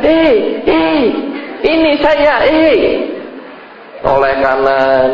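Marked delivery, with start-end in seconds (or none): 0.0-2.8 much higher, insistent call for attention; a child ghost crying out to be noticed. Retold or imitated. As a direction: ghost calling to the person who won't turn around. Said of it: "Dia sudah teriak lagi".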